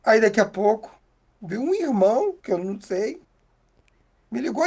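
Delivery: usual speaking voice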